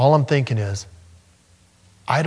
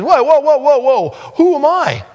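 whoa, whoa, much higher and louder, alarmed; startled protest; 14 semitones higher, 5 dB louder than usual. Retold or imitated. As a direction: recoil in alarm at the call. Moses protests God's call.

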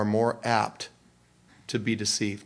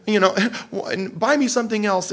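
put-on high, louder whiny teen voice; whiny teenager; 9 semitones higher, 4 dB louder than usual. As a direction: whine like a spoiled kid. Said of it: "but they say".

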